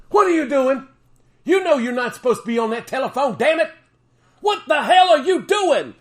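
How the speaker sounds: hammed-up angry shriek; furious scolding yell. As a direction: chew someone out for using the phone. Pretend scolding someone on phone.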